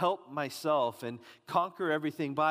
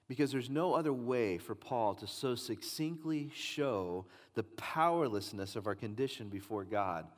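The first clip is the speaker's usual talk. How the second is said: usual speaking voice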